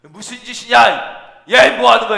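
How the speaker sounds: acted sharp telling-off; scolding. Imagined rebuke of the men dropping dust, scolding. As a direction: scold them for what they are doing. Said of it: "이렇게 혼내키시자는".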